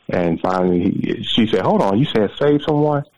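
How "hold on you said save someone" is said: retold startled double-check, pitch climbing; higher-pitched, incredulous question; dawning disbelief. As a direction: imitate a woman stopping short in surprise.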